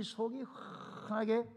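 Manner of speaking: 훤하게 with a long breathy opening before the vowel; drawn-out emphasis. Real, not acted. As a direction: stretch the first sound of 훤하게 long and airy. Draws out how see-through it is. (훤하게의 첫소리를 길게 끌어 강조)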